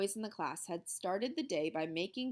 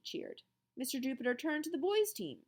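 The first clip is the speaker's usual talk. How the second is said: ordinary delivery, nothing marked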